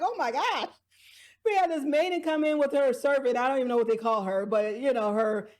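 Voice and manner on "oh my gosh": shocked gasp. Retold or imitated. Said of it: "This is like".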